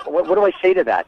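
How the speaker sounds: usual speaking voice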